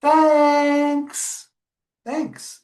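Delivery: high, loud duck thanks; happy duck. Acted. Teacher reading to children, high grateful duck voice. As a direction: beam it out high like a rescued duck. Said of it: "the voices were distinct for each animal".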